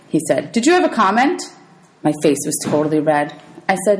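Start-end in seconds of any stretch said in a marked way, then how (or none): 0.5-1.4 stern teacher. Acted. Teacher reprimands Percy.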